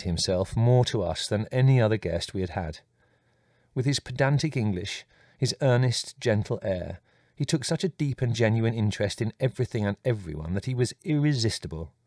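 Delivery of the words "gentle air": usual speaking voice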